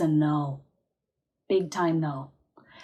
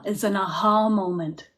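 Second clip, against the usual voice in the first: ordinary delivery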